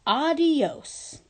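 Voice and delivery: "adios" said the American way, three syllables ah-dee-ohs. A narrator's reading. Principal telling the bully goodbye, "adios" with an American accent. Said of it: "She pronounced adios like an American".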